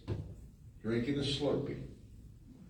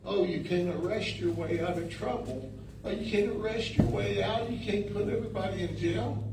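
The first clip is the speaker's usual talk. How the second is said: lightly mocking whine of the critics, higher; a touch of scornful imitation of critics; 6 semitones higher, 3 dB quieter than usual. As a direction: parody the critics' complaint a little. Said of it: "I hear people go".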